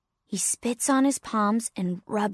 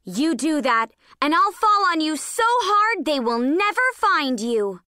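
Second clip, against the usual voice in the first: threatening mother, pitch swooping up; forceful, high-rising protective threat; Mama Pine; 5 semitones higher, 4 dB louder than usual. Acted. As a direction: warn off an attacker with heavy emphasis. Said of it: "Mama Pine".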